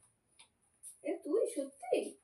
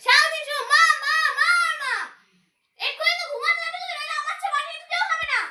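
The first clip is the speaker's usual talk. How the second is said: higher, louder nagging mother, lightly voiced; mother's scolding; 6 semitones higher, 8 dB louder than usual. Mother nagging her child to sleep early, raised shrill-free scolding.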